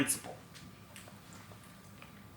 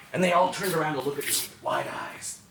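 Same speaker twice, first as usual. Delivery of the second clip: ordinary delivery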